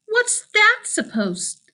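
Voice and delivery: high, slow indignant question; offended. Acted. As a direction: rise up in disbelief. Sasha offended.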